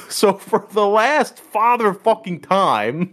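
slow, high-pitched mock tirade; bitching out. Band teacher at the end of his rant, hammed-up anger. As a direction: stretch out each word as an exasperated teacher. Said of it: "he's bitching this kid out".

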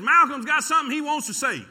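high, loud call to the whole church; loud announcement. Retold or imitated. Dad calling out to the church, loud and high. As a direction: announce it to the congregation, booming.

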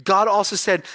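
ordinary talk

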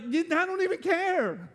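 high, slow brush-off; dismissive God. Retold or imitated. God unmoved by church renovations, slowly dismissive. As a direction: drag it out, unimpressed. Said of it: "And God's like".

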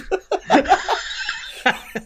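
airy unvoiced laugh; breathy laugh. Real, not acted. Laughing at the kid renting the film, an airy laugh. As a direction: let a silent laugh escape.

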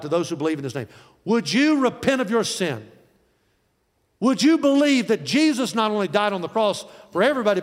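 high, driving, urgent altar call; full-force preaching. Acted. Preacher urging listeners to believe and receive, full-force preaching.

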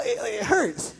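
high, soft pained voice; pained whimper. Retold or imitated. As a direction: wince through the words. Reacting to hair pulled.

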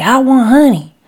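put-on high, loud child's whine; wailing little girl. A narrator's reading. Little Lucy refused honey by mother, wailing. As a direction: wail a demand like a small child. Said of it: "she starts to cry and starts to wail".

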